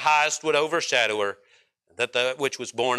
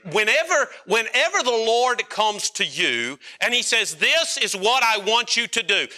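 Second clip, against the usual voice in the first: impassioned preaching; 9 semitones higher than usual. Acted. Preacher urging the congregation, fervent high preaching. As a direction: lift the congregation with rising fervor. Preacher builds to raised fervor.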